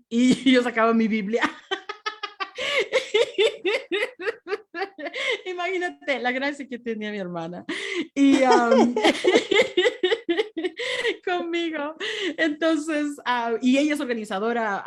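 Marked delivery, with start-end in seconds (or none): 1.5-5.4 long high rising laughter; helpless giggling. Real, not acted. Laughing about sister's questions. 9.0-11.2 high fading laughter; laughing. Real, not acted. Laughing at sister's grace.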